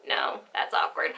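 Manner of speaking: hushed whisper to herself. Real, not acted. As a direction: drop to a sudden whisper. Whispered aside to self.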